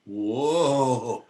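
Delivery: retelling the first moment the trip hit; mock amazed whoa. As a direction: act out the face's amazement. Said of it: "my face just went".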